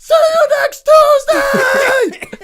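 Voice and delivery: screeching high, very loud, stretched out; yelling. Retold or imitated. Rider raging over his moped, full-blast drawn-out scream. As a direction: scream it slowly with everything you have. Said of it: "I'm just yelling that".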